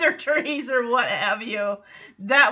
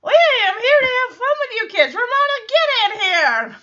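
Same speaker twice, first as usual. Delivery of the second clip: much higher and louder, fast goofy dad voice; Joey being silly; 10 semitones higher, 5 dB louder, faster than usual. Retold or imitated. Podcaster imitating Joey calling to the kids, high and loud. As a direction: ham it up as the goofy uncle calling out. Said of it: "as he says".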